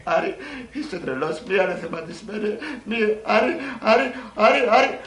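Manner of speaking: high, climbing mock protest; hammed-up woman, building to a loud peak; film heroine. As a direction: play a woman begging her lover to stop. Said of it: "Αυτή, Άρη".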